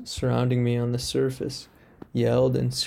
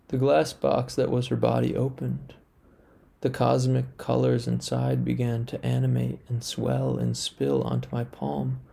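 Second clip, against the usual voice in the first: ordinary delivery, nothing marked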